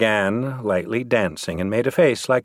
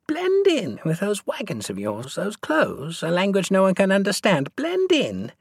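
high-pitched scoffing woman; mocking disbelief; 11 semitones higher than usual. A narrator's reading. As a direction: throw "Blend in" back high, in ridicule. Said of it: "A woman in the troupe laughed".

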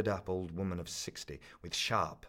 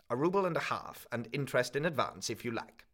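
put-on a higher old woman's curt offer, falling firmly; flat haggling offer; 5 semitones higher, 4 dB louder than usual. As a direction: state the price as final. Old woman names low price.

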